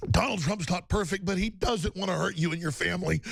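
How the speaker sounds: ordinary delivery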